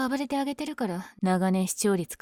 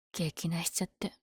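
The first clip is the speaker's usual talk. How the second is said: whisper; 3 semitones lower, 9 dB quieter than usual. Acted. Aside in story.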